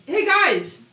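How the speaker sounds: high, loud character call; shout. Acted. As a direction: shout over to the group in alarm. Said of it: "Sophia shouts".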